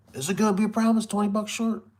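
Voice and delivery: high-pitched mock pleading customer; imitated wheedling. Customer asking seller to accept twenty short, imitated wheedling. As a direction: wheedle your way out, voice pitched well up.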